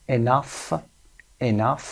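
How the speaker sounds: "enough" ending in a clear F sound; short UH, final F. Shown as a demonstration. Teacher models OUGH words for learners, short UH and final F. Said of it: "به این شکل صدای آ کوتاه دارن".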